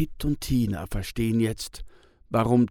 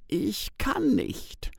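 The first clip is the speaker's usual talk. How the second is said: higher, slow and hushed; halting, pained; 11 semitones higher, slower than usual. A narrator's reading. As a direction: let the words stall: ich kann nicht. Tis, halting admission. (zögernd, leise und langsam: ich kann nicht)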